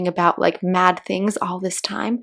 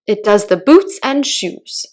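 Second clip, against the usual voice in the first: solemn; 2 semitones higher, 8 dB louder than usual. Acted. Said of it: "the Gryphon replied very solemnly".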